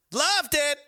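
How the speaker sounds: loud, high burst of enthusiasm; enthusiastic shout. Real, not acted. Host relishing Halloween memories, enthusiastic shout.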